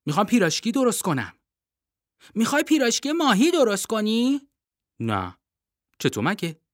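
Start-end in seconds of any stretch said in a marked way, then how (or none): none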